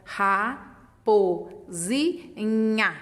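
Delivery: ra, po, zi, nha as separate beats with pauses; teacher showing the word syllable by syllable; sounding out syllables. Shown as a demonstration. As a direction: sound out each syllable slowly and clearly. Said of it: "ó".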